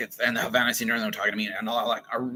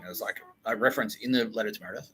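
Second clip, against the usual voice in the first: normal talking voice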